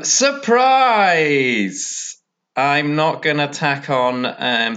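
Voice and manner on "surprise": mock surprise. Sarcastic reveal in post-edit.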